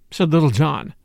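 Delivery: ordinary delivery, nothing marked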